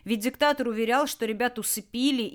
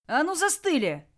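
higher, louder sudden yell; shouted order; 8 semitones higher, 5 dB louder than usual. Acted. Raider to the guards as he bursts in, shouting. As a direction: shout it to freeze the room. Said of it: "выкрикнул".

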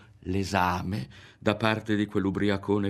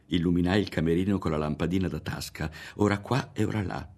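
plain reading voice

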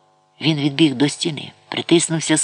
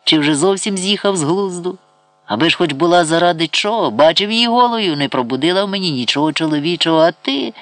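put-on raised, higher-pitched drunken scorn; heated contemptuous tirade; derisive; 6 semitones higher, 3 dB louder than usual. A narrator's reading. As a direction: taunt insolently, building in force. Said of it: "засміявся Ібрагім".

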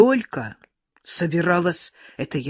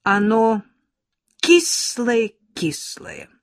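drawn-out, insistent, higher and louder; convinced; 6 semitones higher, 6 dB louder, much slower than usual. A narrator's reading. Schoolgirl Valechka insisting to classmates the apple is sour, convinced. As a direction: stretch out кислое-кислое as if sure of it. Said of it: "убеждённо говорила Валечка".

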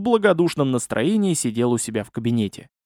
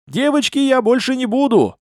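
high, louder plea; pleading; 11 semitones higher, 4 dB louder than usual. A narrator's reading. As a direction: beg the pursuers to let you off. Fleeing hero pleads with chasers.

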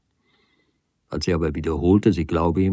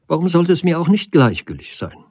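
high, indignant retort; irritated; 8 semitones higher, 3 dB louder than usual. A narrator's reading. Woman provoked by man's yielding, irritated retort. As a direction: fling the question back, provoked. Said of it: "durch seine Nachgiebigkeit gereizt".

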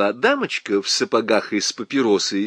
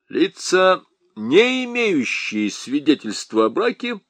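lieutenant reciting regulations at Nyura; official droning; 6 semitones higher than usual. A narrator's reading. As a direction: drone it like reading a decree. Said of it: "забубнил он, словно читал постановление".

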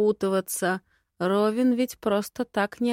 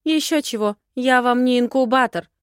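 raised, loud retort; offended, dismissive snap; indignant refusal; 5 semitones higher, 8 dB louder than usual. Acted. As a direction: refuse with higher-pitched indignation. Heroine refuses choking lord's plea.

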